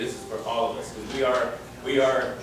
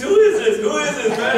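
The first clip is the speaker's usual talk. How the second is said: much higher and louder, laughing insistence; excited demand; 12 semitones higher, 9 dB louder than usual. Retold or imitated. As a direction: retell Duke's squealing demand. Duke demands the caller's name.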